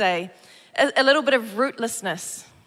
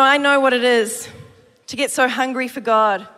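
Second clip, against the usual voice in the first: ordinary delivery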